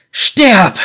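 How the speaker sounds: high, loud scream of rage; boy's scream. A narrator's reading. Boy attacks the mercenaries, screaming. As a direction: hurl the word like the stone. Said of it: "schrie der Junge".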